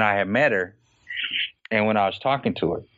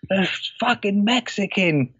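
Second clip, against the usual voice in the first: slow, high mock-angry slur; a hammed-up boss, cussing slowly; imitating angry boss; 8 semitones higher than usual. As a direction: drag the words out like the ranting VP. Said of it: "He's like".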